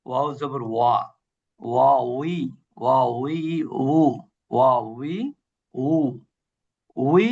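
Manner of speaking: ওয়া and উই with rounded lips, a light w and no v; drilling waw sounds. Shown as a demonstration. Teacher drilling the class on ওয়া উই. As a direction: repeat ওয়া উই in short separate beats. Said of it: "দুই ঠোঁট থেকে উচ্চারিত হয় ওয়া".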